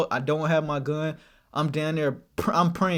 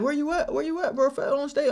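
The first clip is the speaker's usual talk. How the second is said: friend on the phone; 9 semitones higher than usual. Retold or imitated. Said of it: "He's like".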